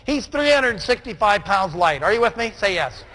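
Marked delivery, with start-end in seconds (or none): none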